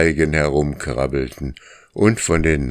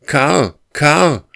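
loud, high bellowing call; a moose's roaring shout; roaring cry; 12 semitones higher, 6 dB louder than usual. Acted. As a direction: cry his name like a bellow. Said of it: "schrie Graufell, und es klang wie ein Brüllen".

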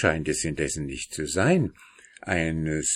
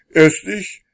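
much higher and louder, insisting; bossy claim; 12 semitones higher, 9 dB louder than usual. A narrator's reading. Older brother claims the telescope from his sister, loud and bossy. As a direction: shove in ahead of your sister.

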